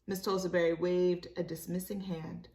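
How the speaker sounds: plain reading voice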